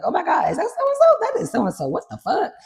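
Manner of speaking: high, excited mock gasping fan; starstruck disbelief. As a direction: play someone stunned to spot a celebrity. Acted starstruck reaction at a party.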